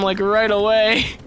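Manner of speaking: normal talking voice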